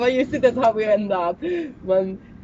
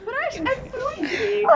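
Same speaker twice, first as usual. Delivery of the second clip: high mocking echo; 11 semitones higher, 3 dB quieter, slower than usual. Echoing the phrase mockingly.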